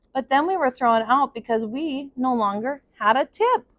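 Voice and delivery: put-on steady storyteller reading to children; story narration. A narrator's reading. Teacher reading a picture book to her class, in a gentle storytelling voice. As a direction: read it out like circle time for kids.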